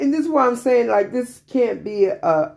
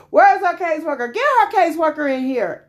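hysterical shouting; 11 semitones higher, faster than usual. Retold or imitated. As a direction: mimic the surgeon yelling into the hallway. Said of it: "he was hysterical".

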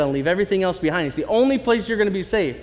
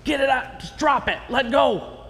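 higher, hurried plea to drop everything; urgent angel; 7 semitones higher than usual. Retold or imitated. Angels hurrying Lot's family out, urgent and higher. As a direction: angel urging them to let go and run. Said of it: "the angels are like".

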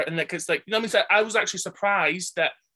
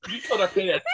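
laughing quote. Retold or imitated. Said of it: "They said".

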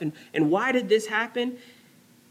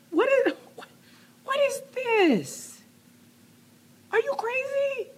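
far higher, put-on voice of God. Preacher voicing God questioning Jonah, high incredulous put-on voice. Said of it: "Verse ten, God says".

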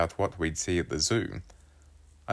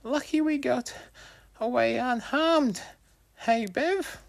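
put-on boy voice. Wolfie voiced by narrator.

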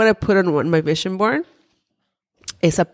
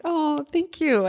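touched thanks; 10 semitones higher, 4 dB quieter, slower than usual. Retold or imitated. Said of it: "And I was just like".

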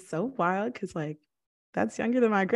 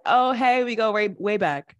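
mock chummy greeting; 4 dB louder than usual. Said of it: "She was like".

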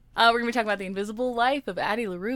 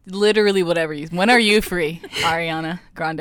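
louder playful appeal; louder teasing plea; calling out; 3 semitones lower, 7 dB louder than usual. Real, not acted. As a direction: call out to her. Calling out to Ariana.

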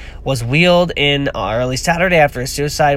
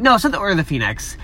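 ordinary talk

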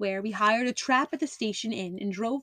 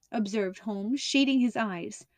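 ordinary delivery

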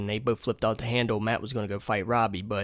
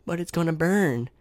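lightly imitated creepy old lady, higher pitched; 6 semitones higher than usual. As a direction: play Gladys's eerie movie line a little higher. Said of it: "That lady's creepy as fuck".

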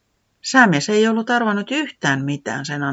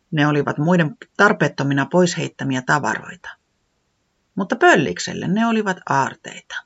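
usual speaking voice

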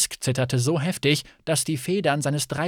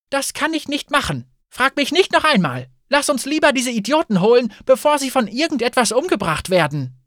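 high, louder agitated protest; exasperated, raised voice; indignant refusal; 8 semitones higher, 5 dB louder than usual. A narrator's reading. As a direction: refuse firmly, then urge action. Indignant refusal, urging rescue instead.

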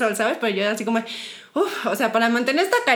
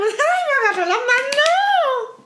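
much higher, slow, laughing squeal; giddy squeal; 12 semitones higher, 3 dB louder, much slower than usual. Retold or imitated. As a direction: stretch it out, dizzy with delight. Quoting her own giddy reaction. (chillido lento y agudo entre risas)

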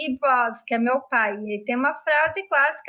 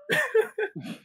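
shrieking laugh; 10 semitones higher, 3 dB quieter than usual. Real, not acted. Refusing to air the clip.

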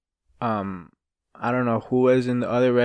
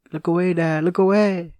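higher, louder mock-pleading voice; panicked daughter; 6 semitones higher, 4 dB louder than usual. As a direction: warn her dad off in a hammed-up panic. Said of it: "And she's like".

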